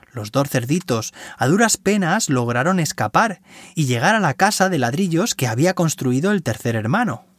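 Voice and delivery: usual speaking voice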